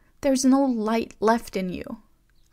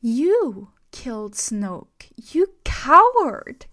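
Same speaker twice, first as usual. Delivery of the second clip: trembling angry outburst; 3 semitones higher, 6 dB louder than usual. A narrator's reading. Rey accusing Kylo of betrayal, shouting with a trembling voice. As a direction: hurl every word at him, shaking. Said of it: "Her voice trembled".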